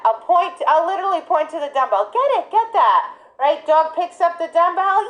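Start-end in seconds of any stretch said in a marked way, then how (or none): 2.1-3.1 high, eager repeated cue; excited urging. Retold or imitated. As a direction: coax the dog toward it. Urging dog to dumbbell.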